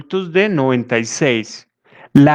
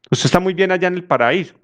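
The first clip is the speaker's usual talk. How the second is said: caricatured reproach; 4 semitones higher, faster than usual. Retold or imitated. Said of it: "haciendo una caricatura".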